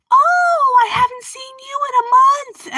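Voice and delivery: put-on very high old-lady greeting; loud gushing opener in a high put-on voice; chirpy elderly neighbor. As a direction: imitate an oblivious grandma greeting. Acts out oblivious elderly neighbor greeting.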